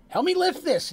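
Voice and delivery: high, loud plea for help; yelling for help. Acted. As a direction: call out urgently to a friend. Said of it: "Deacon's yelling for".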